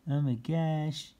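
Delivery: plain reading voice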